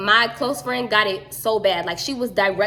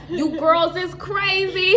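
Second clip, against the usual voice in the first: slow, higher mock Massachusetts accent; 8 semitones higher, slower than usual. The friend's mom from Massachusetts teasing the girls, slow mock accent. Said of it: "she from Massachusetts".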